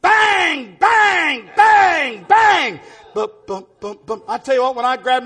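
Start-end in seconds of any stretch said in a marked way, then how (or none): 0.0-2.8 high, loud "bang" on every beat; imitated cymbal crashes. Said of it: "I was banging them all over". 3.1-4.2 soft, low "bum, bum"; little muted taps with the voice; imitated timid tapping. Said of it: "there was no tinkle".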